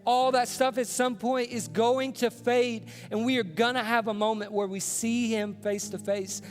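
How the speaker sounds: high, loud and driving sermon voice; passionate preaching. Acted. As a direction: preach it with full conviction. Preaching at full force.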